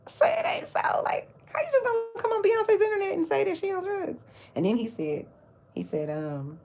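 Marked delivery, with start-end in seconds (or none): 0.1-4.2 high indignant squeal; high-pitched disbelieving protest; mock outrage. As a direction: clap back, high and scandalized.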